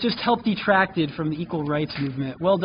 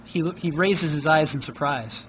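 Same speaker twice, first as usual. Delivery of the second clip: ordinary talk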